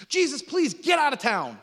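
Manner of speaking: loud, high, urgent plea; pleading townspeople. Acted. Townspeople urging Jesus to leave, loud and high. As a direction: push him out of town, loudly begging.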